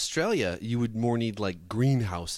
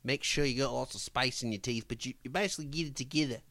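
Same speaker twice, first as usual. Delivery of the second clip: Australian accent; 4 semitones higher than usual. Shown as a demonstration. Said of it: "If you're gonna speak Australian".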